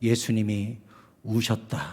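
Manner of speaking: a soft, sorrowful reading of the verse; hushed reading. Shown as a demonstration. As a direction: let "예수님이 우셨다" fall to a hush. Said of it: "이렇게 되어 있어요".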